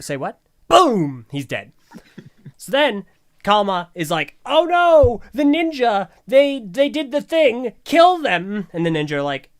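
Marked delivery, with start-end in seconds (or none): 0.7-1.3 loud, punchy explosion word; shouted sound effect. Retold or imitated. Imitated blast in the recap. 4.5-8.7 high, loud hammed-up yelling; mock alarm. Acting Kalma's fake alarm at the killing.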